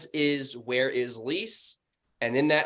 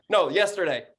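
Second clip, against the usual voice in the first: ordinary talk, nothing marked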